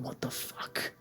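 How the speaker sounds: unvoiced whisper under the breath; whispered inner thought. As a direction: breathe it silently as a shocked thought. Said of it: "even though I'm thinking".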